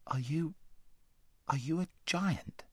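put-on high, timid boy's question; a frightened boy asking; scared boy voice. A narrator's reading.

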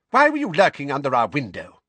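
high, loud accusing question; shrill accusing aunt. A narrator's reading. Aunt demanding why the boy lurked under the window. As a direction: demand it sharply.

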